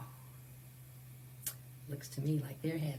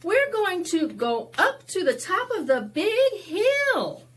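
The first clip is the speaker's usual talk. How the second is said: put-on high, loud eager boy; high child's voice; 12 semitones higher, 8 dB louder than usual. Henry telling Pedro about the big hill, high and eager. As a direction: say it like a kid keen to race uphill.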